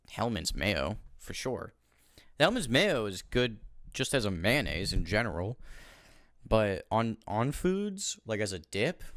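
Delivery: normal talking voice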